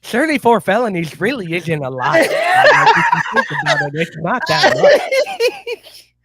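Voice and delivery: high squealing laughing words; mocking while cracking up; laughing sarcasm. Sarcastic mock defense, laughing.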